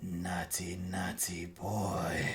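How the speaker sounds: very soft, slow, sinister sing-song; creepy night voice. Retold or imitated. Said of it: "you just hear".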